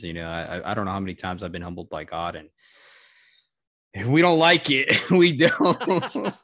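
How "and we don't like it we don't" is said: laughing. Real, not acted. Laughs admitting dislike of humbling.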